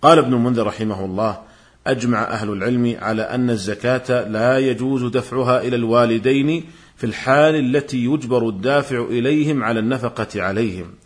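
ordinary delivery

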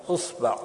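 "أصبع" as usba', u then a; damma on hamza. Shown as a demonstration. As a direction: say "أصبع" with u first and a after the b. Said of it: "ضم الهمزة". (نطق "أصبع" بضم الهمزة وفتح الباء)

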